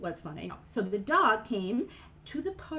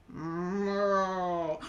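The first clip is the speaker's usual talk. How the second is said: long low moo; cow moo; 4 semitones lower than usual. Acted. Storyteller shows the cow sound, low drawn-out moo. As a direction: chin to chest, moo deep and long.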